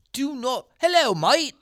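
high, hammed-up British accent; mock British accent, pitched high. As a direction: lampoon an English actor with a British accent. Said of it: "the trailer starts with him going".

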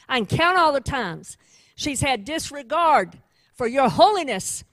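a courtroom accuser building his case, loud and relentless; accusing prosecutor. Acted. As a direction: hammer each charge home before the judge. Preacher voices the accusing prosecutor.

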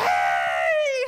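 loud low shouted yell with no words; mock yell. Mum losing it at the barking dog, a wordless yell. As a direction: let out a fed-up bellow. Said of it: "Like this, just loses it".

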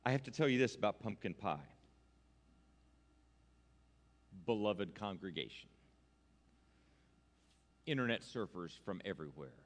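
low, hushed, grave address with long pauses; mock-solemn confession. Preacher confessing to beloved congregation, mock-solemn and low. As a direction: break it to them softly and gravely.

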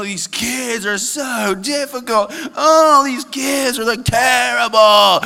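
high, slow, exasperated cry; exasperated parent. Retold or imitated. Pastor playing a fed-up parent, high, slow mock wail.

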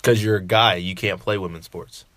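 normal talking voice

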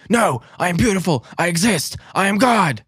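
shouted high and loud, grandiose; mock manic shout. Imitating the tripper answering himself, hammed-up shout. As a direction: proclaim yourself divine at the top of your voice.

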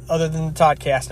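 ordinary delivery

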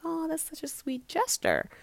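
high-pitched retold "aww" reaction; touched approval. Reacting to friend's surprise dinner gift, touched. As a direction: coo approvingly at a friend's good news.

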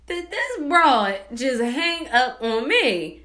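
slow, drawn-out incredulous question; taken aback. Retold or imitated. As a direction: stretch each word in disbelief. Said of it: "I was taken back by it".

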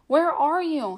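high, loud miserable cry; crying out miserably. A narrator's reading. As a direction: call out lost and wretched. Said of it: "he cried out miserably".

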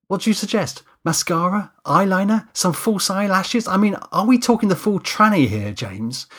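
high-pitched, incredulous makeup artist; voiced Kate, sarcastic. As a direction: reel off mock suggestions as pointed questions. Kate sarcastically challenges James.

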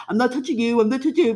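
high, loud mocking singsong taunt; taunting sibling. A bratty sibling taunting the little kid, loud mocking tease.

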